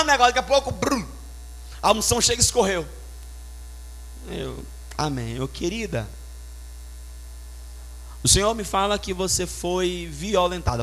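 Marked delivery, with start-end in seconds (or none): 4.3-10.8 much softer, slow and gentle; hushed. Retold or imitated. Preacher retelling his quiet word to a wounded woman, hushed. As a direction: say it gently, almost under the breath.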